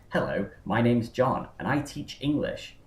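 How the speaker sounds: imitated posh teacher's English; posh it up for foreign students; posh English accent. Teacher overseas introduces himself to students, posh English accent. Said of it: "posh up your accent so your students can understand".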